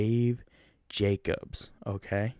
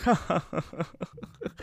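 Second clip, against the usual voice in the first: mock awkward laugh; 9 semitones higher than usual. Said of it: "he was like".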